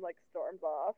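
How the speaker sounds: normal talking voice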